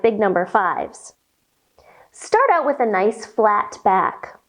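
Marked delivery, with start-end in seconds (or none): none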